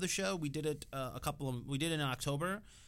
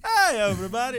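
loud, high cartoon doctor greeting; Nick Riviera greeting; 6 semitones higher, 11 dB louder than usual. Guest quoting the Simpsons doctor, loud cartoon call. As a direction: belt out a cartoon doctor's hello. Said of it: "Nick Riviera from The Simpsons".

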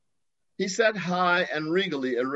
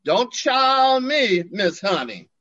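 slow, louder sassy Southern retort with a high swoop; sassy comeback; 6 semitones higher, 4 dB louder, slower than usual. Retold or imitated. Southern sass, stretched out and pointed. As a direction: friend snapping back at narrator, sassy Southern drawl. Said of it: "he shot back".

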